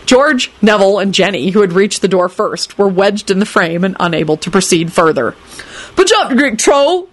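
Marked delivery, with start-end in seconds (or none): 5.9-7.1 high, irritated youngster; irritable. Acted. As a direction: push him aside with an irritable order. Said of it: "George said irritably to Neville".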